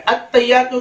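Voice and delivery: "अत्तहियातु" as "at-tayyatu"; "अत्तहियातु" run together with no h; h left out. Shown as a demonstration. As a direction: swallow the ह in "अत्तहियातु". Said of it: "हा हम पूरा गायब कर देते हैं".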